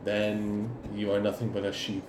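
lightly voiced cult leader, low and quiet; understated villain putting someone down; cult leader's disdain. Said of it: "He's like".

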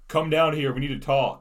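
retold holler up to a balcony; imitated yell. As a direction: call out a demand, imitating someone below. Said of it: "Ryan yells up".

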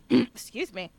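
husky throat-clearing voice; a frog in the throat. Real, not acted. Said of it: "frog in my throat".

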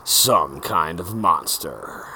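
slow, low announcer intro. Acted. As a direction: deliver it like a show opener.

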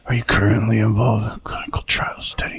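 whispered under the breath; reading to himself. Retold or imitated. Old patient whispering the form question to himself. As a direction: read it silently, half aloud, in a whisper. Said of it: "reading it to himself".

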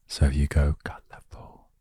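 colourful only mouthed, no voice; mouthed silently. Shown as a demonstration. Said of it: "if you mouth the word colourful".

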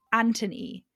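Anthony with a T for the TH; Anthony the British way, no TH sound. Shown as a demonstration. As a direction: say Anthony as "AN-tuh-nee". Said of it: "sounds better in a British accent".

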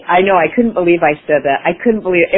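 ordinary talk

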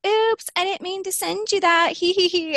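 high, sing-song fake-innocent oops with tittering; mock giggly innocence. Other woman's mock-innocent text, high and giggly.

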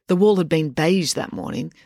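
usual speaking voice

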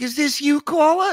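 sing-song host greeting the caller; imitating Rosie. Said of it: "She's like".

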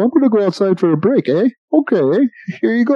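put-on cheerful Canadian accent, easygoing and high. Imitating a relaxed Canadian prison guard letting inmates out, Canadian accent. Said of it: "They just go".

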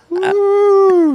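high shrieking mock whoop; shrieking woo. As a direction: squeal a hollow woo. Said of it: "they hear him woo".

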